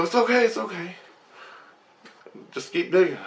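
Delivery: high-pitched soothing voice; reassuring crew. Retold or imitated. Crew calms the man who found bones, high reassurance. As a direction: coo it high to calm the newbie.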